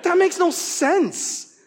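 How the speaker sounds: high, drawn-out mock disbelief; incredulous objection. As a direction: stretch it out in high-pitched incredulity. Scoffs at the withholding father's logic.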